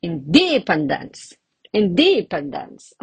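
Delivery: independence with DE stretched and punched; inDEpendence, exaggerated odd stress; stress on second syllable. Shown as a demonstration. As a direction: wrongly hit the DE of independence. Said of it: "Or I can even say".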